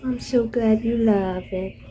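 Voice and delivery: normal talking voice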